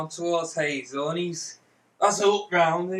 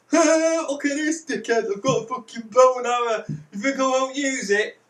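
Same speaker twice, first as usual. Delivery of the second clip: raised, high taunting threat; mock threat; 5 semitones higher, 3 dB louder than usual. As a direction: jeer at him, brandishing a weapon. Retold threat to the victim.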